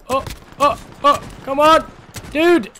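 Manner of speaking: high, loud rising yells; exasperated yell. Real, not acted. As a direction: shout at the game. Tense firefight, exasperated.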